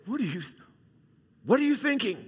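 sharp, high exasperated parent demand; scolding parent. Shown as a demonstration. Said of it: "You might say".

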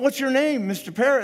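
incredulous burglar. Acted. Burglar questions the parrot.

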